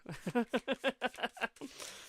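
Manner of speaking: high-pitched staccato giggle; giggling. Real, not acted. Reader amused at not knowing that sort of talk, giggling. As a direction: run of high chuckles. Amused at own awkward remark.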